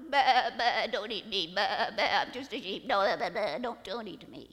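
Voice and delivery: loud, quavering bleats between pleas; bleating sheep lion. Acted. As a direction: beg for your life like a panicked sheep. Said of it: "the sheep lion goes".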